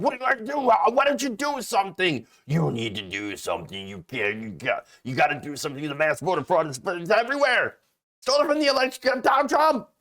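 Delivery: high, shrieking mock rant building to a shout; imitating Bartiromo screaming at Barr. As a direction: ham up a frantic screaming pundit. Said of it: "starts screaming at him".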